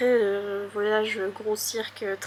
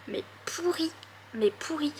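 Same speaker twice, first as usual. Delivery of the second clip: breathy whisper, higher; whispered aside; 4 semitones higher, 7 dB quieter than usual. Real, not acted. Vlogger mocking her own bad memory, whispered. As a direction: hush it like a secret confession.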